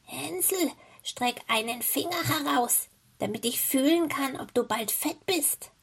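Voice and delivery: put-on high-pitched old witch voice; old witch calling. A narrator's reading. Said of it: "schlich die Alte zu dem Ställchen und rief".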